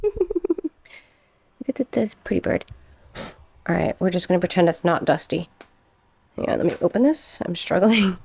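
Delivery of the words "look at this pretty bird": slow, high coo. Real, not acted. Cooing to viewers over a pretty plant, slow and high.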